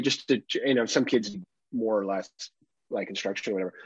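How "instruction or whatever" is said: usual speaking voice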